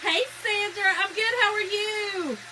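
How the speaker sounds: high, chirpy hello to a viewer; bright greeting. Real, not acted. Greeting Sandra from the chat, high and sing-song.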